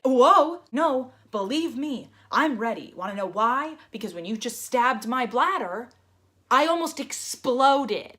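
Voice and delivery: insistent outburst at the nurse; exasperated. Retold or imitated. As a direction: push back hard, voice climbing.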